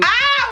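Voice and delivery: sharp high cry of pain; pained yelp. Real, not acted. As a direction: yelp at a sudden cramp. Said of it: "I have a foot cramp".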